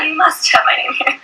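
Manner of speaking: high, indignant mock diva; hammed-up outrage at the check-in desk; mock diva indignation. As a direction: play the offended star, tongue in cheek. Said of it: "I was like".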